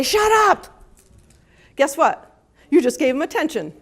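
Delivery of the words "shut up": sharp, high, loud "shut up"; annoyed snap. Retold or imitated. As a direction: snap at the barking dog. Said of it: "you say".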